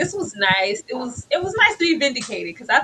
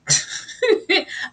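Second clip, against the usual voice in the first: laugh; 10 semitones higher than usual. Real, not acted. Laughing about Mary.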